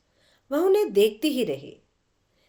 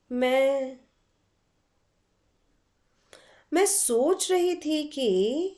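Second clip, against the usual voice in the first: hesitant; 4 semitones higher, slower than usual. A narrator's reading. Lost in thought, hesitating.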